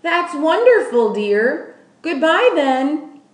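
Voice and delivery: putting on a brave face; tremulous. Acted. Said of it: "Nellie's tremulous voice".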